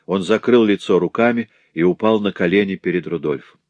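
ordinary delivery

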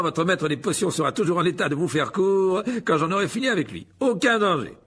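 loud, high, theatrical host; pompous showman teacher. A narrator's reading. Lockhart presents his duelling club to the pupils, pompous and projected. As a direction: play the vain professor addressing a crowd.

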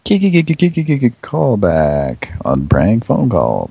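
halting k-k-k stammer, then slow; stuttering start. Real, not acted. Radio host stammering into the prank call segment. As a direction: trip over the first word, stammering. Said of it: "Almost said prank phone calls".

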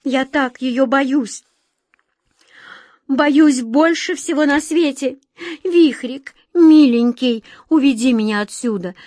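high, loud begging, slowing on some words; fearful pleading. A narrator's reading. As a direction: plead to be hidden away. Frightened girl begs Vikhrik.

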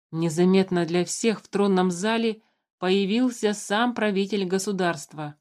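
ordinary delivery, nothing marked

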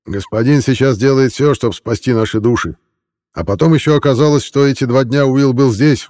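put-on a drunk man pouring out his grievances; bitter drunk villager. Malik's drunken complaint voiced.

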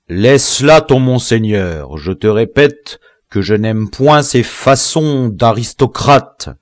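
slow, loud, emphatic rebuke; impatient reprimand; irritated order. A narrator's reading. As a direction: spell out your displeasure with force. General angrily rejects title.

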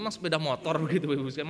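usual speaking voice

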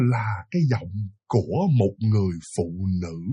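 very low, soft storyteller voice; ominous drop into near-murmur; hushed reveal. A narrator's reading. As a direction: let the voice sink for a chilling reveal. Dramatic reveal in ghost story.

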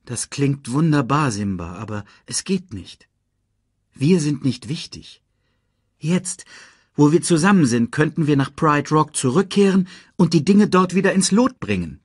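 lightly voiced worried lioness; lightly voiced Nala.

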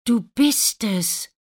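high, slow, loud wonder; joyful exclamation. A narrator's reading. Prince recognises Lina, exclaiming joyfully. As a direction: cry out in happy recognition. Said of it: "ruft der Prinz".

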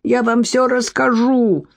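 slow, high, drawn-out groan; moaning. A narrator's reading. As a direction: plead in a stretched, pained moan. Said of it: "простонал специалист".

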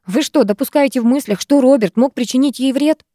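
worked-up protest; heated. A narrator's reading. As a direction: challenge them in indignation. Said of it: "кипятилась я".

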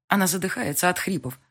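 ordinary delivery, nothing marked

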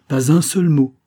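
loud, high, emphatic repeat; resolute, forceful refusal; determined. A narrator's reading. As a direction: insist firmly, pressing harder. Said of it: "dit-elle avec détermination".

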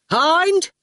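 high-pitched summoning shout. Acted. Shine summons Hind, shouting. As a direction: call a henchman from the next room. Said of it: "she shouted".

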